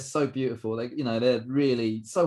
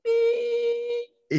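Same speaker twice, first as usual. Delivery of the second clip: high, held-out imitated beep; mock flatline beep. Coach recalling a punch that blanked him, high beep. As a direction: sound it like a monitor tone. Describing being knocked senseless.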